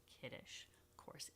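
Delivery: whispered aside; playful whisper. Real, not acted. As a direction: whisper it like a little secret. Aside to viewers about Kiddush.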